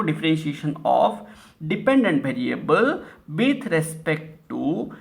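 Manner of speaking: very slow, word by word; teacher dictating the definition; slow dictation. Shown as a demonstration. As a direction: spell the rule out slowly for the class. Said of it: "इसको आप कैसे लिखेंगे".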